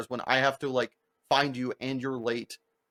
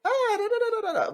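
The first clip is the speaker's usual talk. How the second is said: mock high singsong server voice, much higher; octave-up server voice. Server greeting diners in a high singsong. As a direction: chirp it an octave up like a peppy server. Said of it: "they've turned it an octave up".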